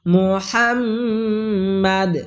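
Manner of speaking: long hummed m in মুহাম্মাদ; held ghunnah. Shown as a demonstration. Teacher showing the mim rule, মুহাম্মাদ with long ghunnah. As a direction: chant মুহাম্মাদ slowly, holding the doubled m. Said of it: "গুণনা করতে হবে".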